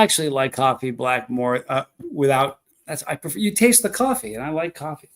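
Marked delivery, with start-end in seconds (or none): none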